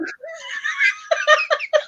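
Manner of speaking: high shriek of laughter. Real, not acted. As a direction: burst into a squealing laugh. Bursts into laughter.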